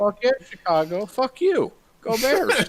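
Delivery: root like a rowdy Chicago fan; mock Chicago sports fan; Chicago accent. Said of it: "How about them Cubbies".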